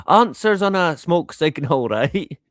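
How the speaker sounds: ordinary talk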